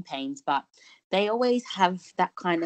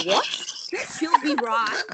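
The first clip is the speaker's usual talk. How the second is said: confused raised echo, higher; mock baffled repeat of a strange phrase; puzzled echo; 8 semitones higher than usual. Friends echoing an Aussie phrase, baffled and higher. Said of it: "they were like".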